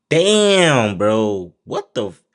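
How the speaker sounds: teriakan kaget yang keras; shocked shout. Real, not acted. Shocked reaction to the killing.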